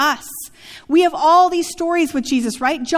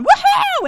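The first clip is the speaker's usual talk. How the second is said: high whooping yell, much higher and louder; Howard Dean scream; 5 dB louder than usual. Retold or imitated. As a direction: mimic a wild rally whoop. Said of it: "he did that one weird scream".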